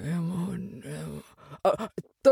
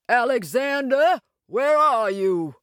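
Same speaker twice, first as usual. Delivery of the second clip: raised, high calling voice; 5 semitones higher than usual. Acted. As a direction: call across the room. Calling out to Bell.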